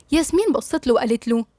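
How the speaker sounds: plain reading voice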